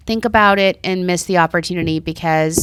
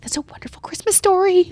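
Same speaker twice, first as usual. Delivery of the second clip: delighted stranger; 11 semitones higher than usual. Retold or imitated. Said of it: "He's like".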